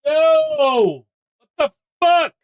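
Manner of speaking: high-pitched, exasperated outburst; real fury, pitched very high; furious. Real, not acted. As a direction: cry out in angry disbelief, voice shooting way up. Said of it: "fucking furious".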